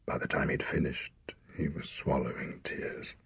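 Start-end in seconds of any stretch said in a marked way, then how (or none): none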